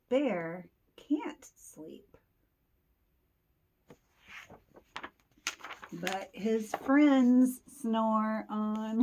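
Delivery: very slow, stretched words; slow drawn-out refrain. Acted. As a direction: linger on every word. Refrain read slowly.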